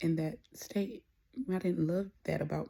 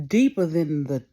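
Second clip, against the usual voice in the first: emphatic; 11 dB louder than usual. Real, not acted. Exaggerated emphasis on depth.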